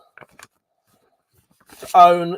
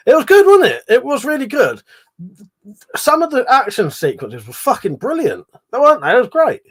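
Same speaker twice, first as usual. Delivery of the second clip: excited; 8 semitones higher, 7 dB louder than usual. Real, not acted. Enthusing about the show.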